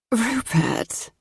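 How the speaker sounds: soft, sighing the name; sighed. A narrator's reading. Matilda sighing her boyfriend's name awake. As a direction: let the name out on a sigh. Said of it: "she sighed".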